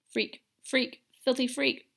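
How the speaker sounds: put-on a girl spiralling into panic. A narrator's reading. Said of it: "Ariana muttered once more".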